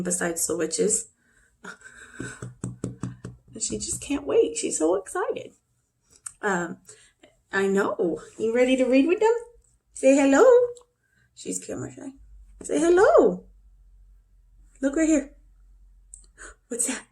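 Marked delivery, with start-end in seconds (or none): 3.6-5.5 voicing the dog. 8.4-10.4 coaxing. Real, not acted. Coaxing the dog to greet. 12.6-13.4 high squeaky little dog voice; voicing the dog. Owner speaks for her dog, high and squeaky. Voicing the shy dog's greeting.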